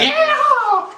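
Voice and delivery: a sudden high whooping yeah; excited yell. Acted. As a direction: burst in hyped, pitch jumping way up. Hyped channel intro yell.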